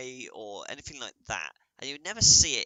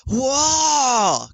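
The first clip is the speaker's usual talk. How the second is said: loud, high, drawn-out whoa; over-the-top whoa; 11 dB louder than usual. Retold or imitated. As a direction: gasp it like an anime shock. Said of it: "and then we go".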